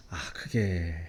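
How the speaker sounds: low, soft hesitant mumble. Retold or imitated. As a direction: trail off, unsure how to refuse. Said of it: "하면서 망설이는데".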